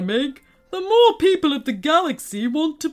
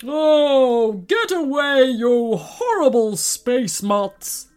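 loud bellowing giant; angry bellow; 5 dB louder, slower than usual. A narrator's reading. Giant villain drives off space dogs, bellowing. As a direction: bellow it slowly at the intruders. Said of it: "bellowed the giant".

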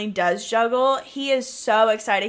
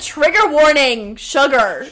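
louder, mock stern; mock stern warning; 3 semitones higher, 7 dB louder than usual. Host mocking the diabetic character, in a louder mock stern warning. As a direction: warn sternly with a wink. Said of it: "She said".

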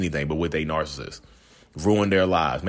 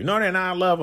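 put-on high, dreamy lovestruck girl voice; mock smitten girl; 13 semitones higher than usual. Dad mimics a daughter defending her boyfriend, high lovestruck voice. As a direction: imitate a teen defending her older man.

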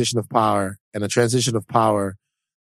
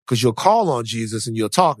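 ordinary delivery

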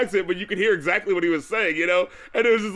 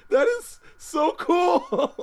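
slow, high, laughing "so cool"; delighted, laughing all through; 12 semitones higher, much slower than usual. Real, not acted. Telling co-host the reference, slow delighted laughter.

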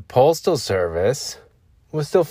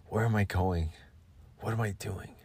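soft, hushed moody ad voice; perfume ad monologue; 2 semitones lower, 5 dB quieter than usual. Pretend. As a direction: breathe it like a cologne ad.

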